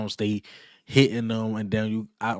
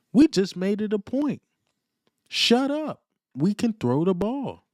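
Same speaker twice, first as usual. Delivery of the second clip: heated; 12 semitones higher than usual. Real, not acted. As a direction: rant with rising heat. Heated rant at rival fans.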